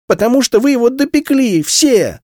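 put-on high, loud childish accusation; accusing girl. A narrator's reading. A girl blames her whole family, high and loud. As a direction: hurl the blame like a wounded little girl.

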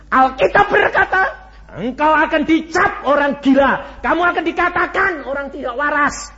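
loud, high testimony cry; preaching at full force. Acted. Preacher proclaiming his own practice to the congregation, full force.

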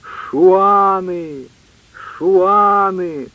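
long-held, wailing hail; mysterious call. A narrator's reading. Mysterious cry to those in the woods, long drawn-out call. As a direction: draw the cry out like a far-off signal. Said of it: "Снова таинственный клич".

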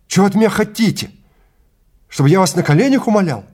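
high, loud and fast hysterical cry; hysterical plea. Acted. Eckels begging the guide, fast and high. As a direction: break into a panicked plea.

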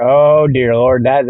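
ordinary talk, nothing marked

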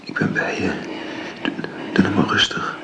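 calm her down gently in a whisper; soothing whisper. Acted. Woman soothes her dying friend in a gentle whisper.

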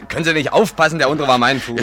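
loud, high, indignant outcry; angry complaint. Acted. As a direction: yelp at the clumsy stranger, then scold. Stepped on, complains loudly. (laut und empört)

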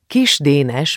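plain reading voice

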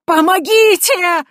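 slow, high, loud wail for help; cry for help. Acted. Priest under blows screaming for help, high drawn wail. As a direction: draw out the desperate plea.

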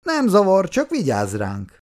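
mock-compliant jibe, starting high and falling. A narrator's reading. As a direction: remark with open sarcasm. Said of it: "gúnyorosan megjegyezte".

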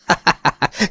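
high, brief acted laugh; light, high-pitched laughter; amused chuckle. A narrator's reading. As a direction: chuckle through a broad grin. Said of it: "André szélesen vigyorogni kezdett".

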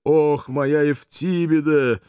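slow, tender, full of love; tender loving voice. Acted. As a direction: sigh her name lovingly. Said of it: "нежным, полным любви голосом".

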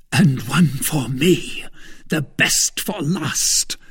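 slow, deliberate controlled fury; furious cry, understated. A narrator's reading. Wounded swordsman strikes back, understated furious cry. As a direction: spell out each word as a vengeful retort. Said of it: "cried D'Artagnan, furious".

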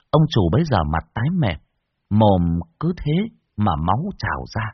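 ordinary delivery, nothing marked

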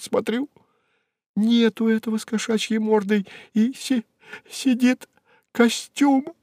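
high, overwrought woman's voice; agitated retelling. Acted. Secretary telling the accountant of the cat visitor, agitated. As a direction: tell it high-pitched and upset, acting out each party. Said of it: "трясясь от волнения".

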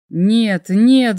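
loud desperate cry of no; shouting. A narrator's reading. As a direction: yell it out in panic. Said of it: "закричал я".

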